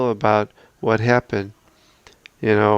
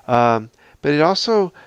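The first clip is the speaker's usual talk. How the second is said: ordinary delivery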